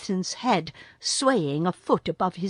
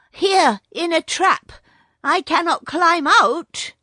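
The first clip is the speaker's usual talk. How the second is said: high, loud cry up from the trap; 10 semitones higher, 8 dB louder than usual. A narrator's reading. Trapped boy Mowgli calls up to his friends, crying out. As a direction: call for help, pitched high and loud. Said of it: "cried Mowgli".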